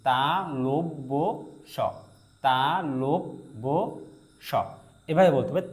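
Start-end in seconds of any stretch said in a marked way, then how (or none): 0.1-4.5 তালব্য very slowly as ta, lob, bo, then স; teacher dictating তালব্য স piece by piece; slow syllabification. Shown as a demonstration. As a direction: stretch each part of তালব্য, pause before স. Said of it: "এই যে".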